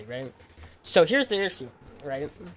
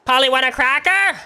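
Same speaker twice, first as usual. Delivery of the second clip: very high, loud squawking parrot; 10 semitones higher, 10 dB louder than usual. Retold or imitated. Teen compares the neighbor to a parrot, loud high squawk. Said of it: "like, the parrot from Aladdin".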